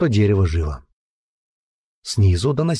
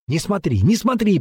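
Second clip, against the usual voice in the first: urgent pleading; 9 semitones higher than usual. A narrator's reading. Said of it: "приговаривала Вилея".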